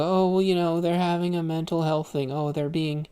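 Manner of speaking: high, loud, mocking sympathetic whine; mocking other people. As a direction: parody the bleeding hearts in a high, loud whine. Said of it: "and then other people go".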